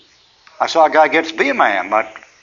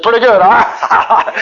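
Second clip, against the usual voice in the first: high, loud and slow, laughing through the words; grudging laughing reply; 8 semitones higher, 5 dB louder, much slower than usual. Retold or imitated. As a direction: mimic a man laughing off defeat. Imitating husband's grudging reply.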